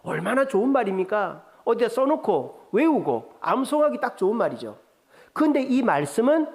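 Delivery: usual speaking voice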